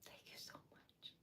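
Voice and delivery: very soft whispered thanks; tender whisper. Real, not acted. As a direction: breathe it out gratefully.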